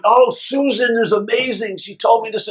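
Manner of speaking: put-on high gushing girlfriend voice. Boyfriend imitates girlfriend praising Susan's advice, high gushing. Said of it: "my girlfriend will call me".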